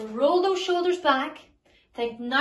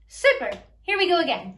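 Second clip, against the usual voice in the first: upbeat coaching; 5 semitones higher, 7 dB louder than usual. Real, not acted. Coaching kids through lip trill.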